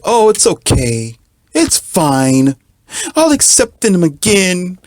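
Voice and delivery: high, slow, meek forgiving voice; mock pushover voice. Imitating a pushover to a cheating partner, high and meek. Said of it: "And you're like".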